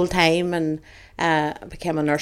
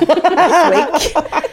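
laughing; 10 semitones higher, 6 dB louder than usual. Real, not acted. Laughing about broom joke.

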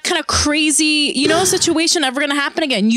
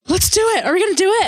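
much higher, eager quoted lines; eager mock excitement; 8 semitones higher, much faster than usual. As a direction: sound giddy and keen, pitched up. Retelling eager hookup exchange.

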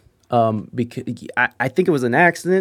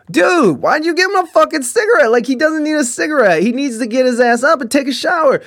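much higher, louder tell-off; angry scolding; 12 semitones higher, 4 dB louder than usual. Retold or imitated. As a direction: chew him out, fast and heated. Scolding Michael for cigarette.

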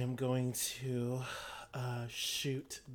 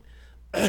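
high unvoiced laugh burst; 9 semitones higher, 12 dB louder than usual. Real, not acted. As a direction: laugh out loud. Laugh after coughing bit.

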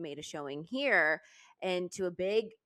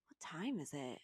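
whisper; 4 semitones lower, 13 dB quieter than usual. Retold or imitated. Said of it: "and she goes".

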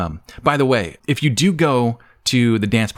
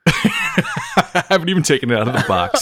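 higher-pitched, laughing through the words; 7 semitones higher than usual. Real, not acted. Host admitting the new megaphone is untested, laughing. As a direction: crack up while admitting it.

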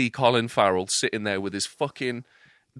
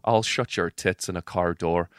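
Irish accent; 8 semitones lower, 5 dB quieter than usual. Shown as a demonstration. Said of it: "a key phrase to get me into the accent".

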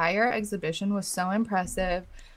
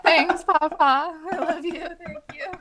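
sweetly embarrassed reply; 8 semitones higher than usual. Retold or imitated. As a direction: imitate thanking grandpa in a little high voice. Acts out own reply to grandpa.

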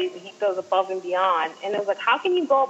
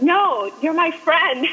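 lightly acted higher-pitched insistence; insistent reassurance; 7 semitones higher, 3 dB louder, much slower than usual. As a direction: retell his firm, friendly correction. Retelling idol insisting she's a friend.

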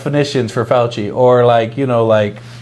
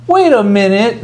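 high, louder imitation of public doubt; mock disbelief; 8 semitones higher, 4 dB louder than usual. Podcaster voicing the American people catching on, mock disbelief. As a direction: put on a high, incredulous voice.

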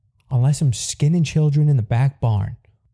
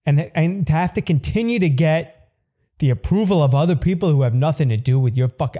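ordinary talk

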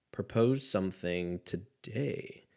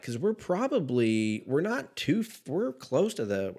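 ordinary talk

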